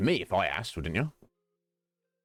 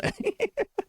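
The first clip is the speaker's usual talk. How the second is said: shrill giggle burst very high; high squealing laugh. Real, not acted. Streamer cracks up at own joke, high giggle.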